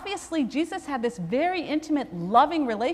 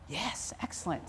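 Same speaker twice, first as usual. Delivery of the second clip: dry, much softer "yes, excellent"; wry relief; 6 semitones lower, 13 dB quieter than usual. Retold or imitated. Chaplain told he isn't needed, dry relieved aside. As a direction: mutter it with sheepish relief. Chaplain dismissed by dying atheist.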